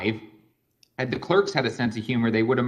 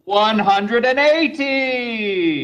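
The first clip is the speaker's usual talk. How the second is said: drawn-out British darts call, much higher, slow; darts caller call; 10 semitones higher, 5 dB louder, much slower than usual. Shown as a demonstration. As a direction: stretch it out like a darts announcer. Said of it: "All you need to do is the number".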